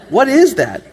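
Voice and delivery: laughing, baffled what is that?; puzzled laugh. Real, not acted. Puzzled by the angels verse, laughing in disbelief. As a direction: ask it half-laughing, mystified.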